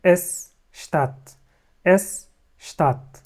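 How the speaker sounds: شتات said slowly, its S sounded as sh before the t; slow German pronunciation. Shown as a demonstration. Teacher to students, showing the S in شتات as sh, slowly. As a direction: draw out شتات, sh at the start. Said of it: "بننطقها زي الشين".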